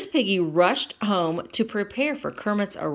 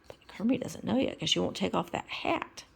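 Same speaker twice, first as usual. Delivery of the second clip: whispering; 6 dB quieter than usual. Real, not acted. Whispered aside.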